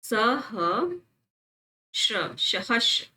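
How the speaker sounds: सहस्र broken slowly, sa... ha... shra; syllable by syllable. Shown as a demonstration. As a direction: spell out सहस्र in slow syllables. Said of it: "ऐसे". (सहस्र धीरे-धीरे तोड़कर)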